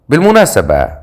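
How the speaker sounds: usual speaking voice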